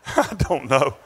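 chuckling through the words, slowed; laughing. Real, not acted. Preacher laughing to congregation about closet tongue talker. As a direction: laugh it out, amused and unsure.

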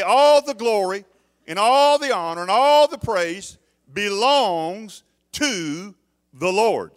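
loud, slow, drawn-out proclamation of praise; loud preaching. Acted. Pastor proclaiming to the healed friend, loud slow preaching. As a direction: ring it out like a preacher at full force. Pastor acts out giving God glory.

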